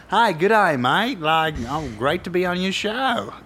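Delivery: a cheery hint of Australian; mock Australian accent. Imitating Nicole Kidman on a talk show, light Australian accent. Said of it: "You like my Australian accent?".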